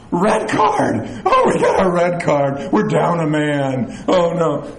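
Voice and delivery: emphatic, hammed-up sports-fan dismay; mock alarm. Fan seeing a red card, mock alarm. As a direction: cry out at the bad call.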